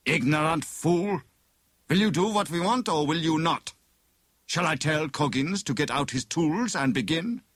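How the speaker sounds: high-pitched, impatient captor; angry villain. A narrator's reading. Bogus inspector threatens prisoner with Coggins' tools, angrily impatient. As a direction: threaten him in exasperation.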